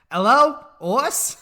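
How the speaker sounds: put-on high, loud, slow toddler with a New York accent; drawn-out mock New York kid voice; daughter's New York accent. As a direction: imitate a two-year-old answering the phone, New York vowels. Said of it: "a little bit of a New York accent".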